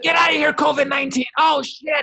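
high, loud hammed-up yell; screamed out high in mock terror; mock panicked shout. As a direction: yell it like a horror movie victim. Mock movie scene, fighting covid.

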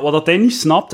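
normal talking voice

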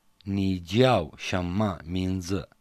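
slow, syllable by syllable; slow repeat after teacher. Shown as a demonstration. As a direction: echo the teacher slowly. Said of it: "دعنا نتمرن على هذا السؤال".

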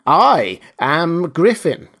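slow, loud stress on "I"; insistent, "I" punched and drawn out; emphatic insistence. A narrator's reading. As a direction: hit "I" high and hard, then spell it out slowly. The voice insists on identity.

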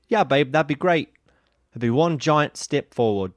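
put-on measured Australian-accented reply; deliberate reply in an imitated Australian accent. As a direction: land the punchline slowly in a mock Australian accent. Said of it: "That's funnier in your accent".

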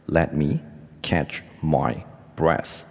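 "Let me / catch my / breath", with pauses between; slow phrase-by-phrase repeat. Shown as a demonstration. Teacher repeating the example for learners, slow and segmented. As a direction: model it slowly, chunk by chunk.

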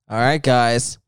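low announcer voice; game show host. Pretend. Host introducing the wheel of fate, low announcer voice.

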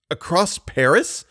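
slow, high, stunned question; drawn out and rising in disbelief; lightly hoarse, incredulous echo. A narrator's reading. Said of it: "in a hoarse voice".

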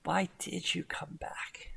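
unvoiced hushed question; serious whisper. Celine asking Zoe why she returned, whispered.

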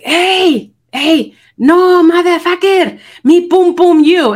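high, loud yell at a bum; shouting at trespasser. Retold or imitated. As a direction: shout him off the doorstep. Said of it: "she's like".

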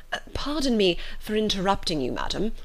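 plain reading voice

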